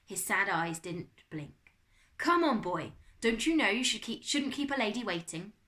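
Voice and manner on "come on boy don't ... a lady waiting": loud, higher French butler; French-accented butler chiding. A narrator's reading. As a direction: hurry someone along in a French accent. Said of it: "It was a French accent".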